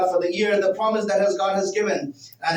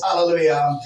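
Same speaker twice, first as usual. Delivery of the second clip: ordinary talk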